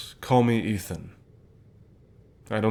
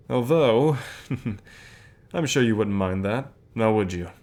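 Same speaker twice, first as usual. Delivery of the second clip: teasing. Acted. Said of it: "Sorry, I couldn't resist".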